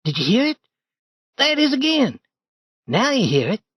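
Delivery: put-on high boyish voice, urgent; a startled boy pointing out a noise; young Tom, alarmed. Tom nudging Huck about a noise, high alarmed boy.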